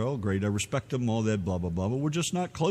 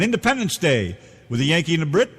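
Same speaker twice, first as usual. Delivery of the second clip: radio host voice; 6 semitones higher, 5 dB louder than usual. Acted.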